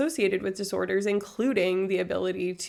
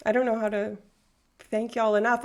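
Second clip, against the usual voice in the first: usual speaking voice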